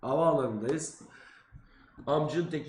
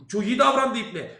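normal talking voice

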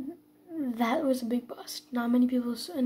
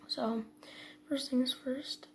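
ordinary delivery

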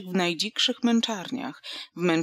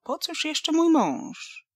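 haughty; 10 semitones higher, 4 dB louder, much slower than usual. A narrator's reading. Cold wife questions Łucja.

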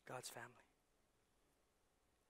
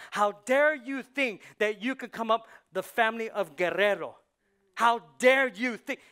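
louder and louder, higher at the end; motivated anger; 3 semitones higher, 8 dB louder than usual. Acted. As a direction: hurl it at your giant, building. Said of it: "a motivated anger that looks at that ugly Goliath".

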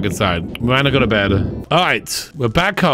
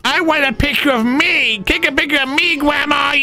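put-on much higher, demanding child; whiny kid voice; 11 semitones higher than usual. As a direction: beg for the camera like a little kid. Voicing kid sim demanding picture.

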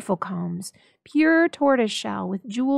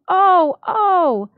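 high, loud excited cry; cry of joy; 9 semitones higher, 10 dB louder than usual. A narrator's reading. Della springing up to show Jim his present, crying out. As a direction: leap up and cry out with delight.

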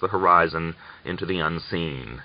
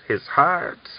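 higher, angry hiss with the rise on heart; 8 semitones higher than usual. A narrator's reading. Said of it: "Miran hissed".

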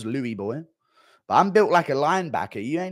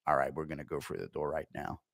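1 soldier on radio; 10 semitones lower, 11 dB quieter than usual. Retold or imitated.

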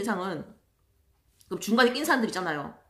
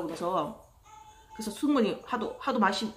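ordinary delivery

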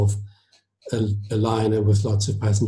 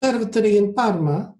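put-on higher-pitched Italian accent; heavy Italian accent; 11 semitones higher than usual. A narrator's reading. Maestro replies to the commodore at dinner, heavy Italian accent. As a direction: answer in a thick Italian accent. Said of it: "in his heavy Italian accent".

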